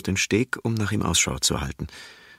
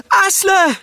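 very high, full-volume cry against the waves; desperate shout; 12 dB louder than usual. Acted. Brita swimming through the waves shouting for Asle, desperate shout. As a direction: shout his name with all your strength. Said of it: "ruft Brita".

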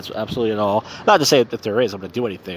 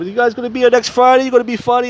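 high-pitched, hammed-up Italian guy; super Italian; 11 semitones higher, 4 dB louder, faster than usual. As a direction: stretch it out like an excitable Italian greeting his pals. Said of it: "he's like, super Italian".

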